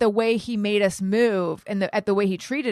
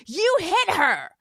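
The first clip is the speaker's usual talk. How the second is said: high, slow, insistent accusation; accusing demo; 9 semitones higher, slower than usual. Shown as a demonstration. To her husband, demonstrating the high accusing voice. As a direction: show exactly how she said it. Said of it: "that, that's how I sound".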